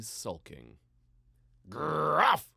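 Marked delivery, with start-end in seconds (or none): 1.7-2.5 a sharp, louder barked word, high; dog bark. A narrator's reading. The dog barking in her sleep, voiced as a loud bark. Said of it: "barked out in her sleep".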